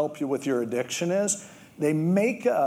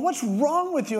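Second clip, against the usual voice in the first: much higher, incredulous; exasperated dad; 11 semitones higher than usual. Retold or imitated. Dad yelling at his son digging through manure, incredulous. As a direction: throw it at the kid in disbelief.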